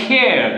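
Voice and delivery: "care" with the final R dropped; silent final R. Shown as a demonstration. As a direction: end "care" on a vowel, leaving out the R. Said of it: "আমরা আর উচ্চারণ করবো না". ("care" শব্দের শেষে র অনুচ্চারিত)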